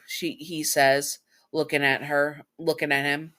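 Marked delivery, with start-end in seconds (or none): none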